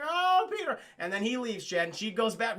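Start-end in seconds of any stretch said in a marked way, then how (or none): 0.0-0.8 swooning. Retold or imitated. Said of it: "She's like".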